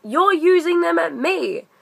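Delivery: mock outraged manager voice; retold indignant retort. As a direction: throw her accusation right back at her. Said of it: "he's like".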